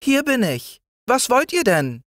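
sehr hohes, feines Stimmchen; Spinnenstimme. A narrator's reading. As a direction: als winzige Spinne antworten. (Spinne antwortet den Kindern mit sehr hohem Stimmchen)

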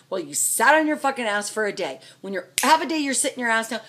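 ordinary delivery

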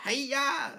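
high-pitched, exclaimed attack yell; mock battle cry. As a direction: imitate a film hero swinging a weapon. Imitates frying pan battle cry.

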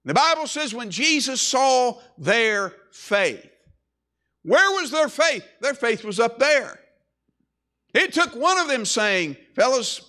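ringing, emphatic preacher's call; emphatic preaching. Acted. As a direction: drive it home to the congregation, drawing out key words. Preaches on their faith.

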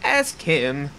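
lightly voiced old wife, slowly; old lady voice. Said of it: "I can't do an old lady voice".

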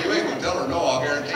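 ordinary delivery

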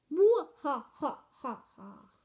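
loud villain cackle fading away; hammed-up evil mwah-ha-ha; mock villain laugh dying off. Evil laugh after evil smile.

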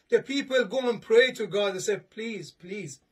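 normal talking voice

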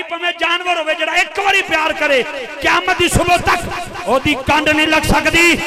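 high, loud, building to a shouted climax; full-force preaching. Real, not acted. Preacher promising the crowd Ali's lasting love, rising to a full shout. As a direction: drive the promise home with mounting force.

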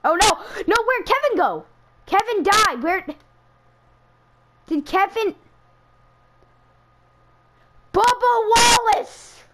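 high and loud, the last name slow; shocked disbelief. Real, not acted. Streamer reading the race winner, shocked high cries.

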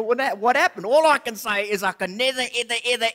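normal talking voice

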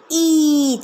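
ई stretched high and loud, a long bright ee; long ee called out. Shown as a demonstration. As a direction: call out ई clearly for the class. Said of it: "चलिए बोलिए मेरे साथ".

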